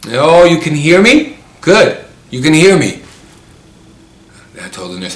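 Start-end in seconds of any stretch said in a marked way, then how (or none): 0.1-3.0 high, loud comeback to a teasing friend; mock-indignant retort. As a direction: pitch it up and punch it, playfully. Acting out her retort to patient.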